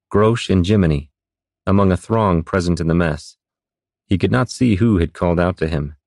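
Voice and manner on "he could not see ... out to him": plain reading voice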